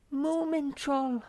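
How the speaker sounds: high, slow breathless call; gasping Snork Maiden. A narrator's reading. Girl calls to friend in dismay, high and slow. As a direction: call his name in shock. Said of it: "gasped the Snork Maiden".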